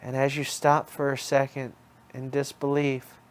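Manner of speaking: ordinary delivery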